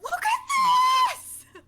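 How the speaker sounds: very high, drawn-out excited squeal; high, loud, slow cry of delight; pumped-up. Acted. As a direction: show it off at full shriek. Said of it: "so pumped".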